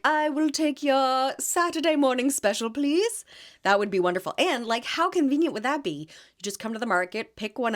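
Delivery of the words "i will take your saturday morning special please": mock polite customer. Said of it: "I'd be like".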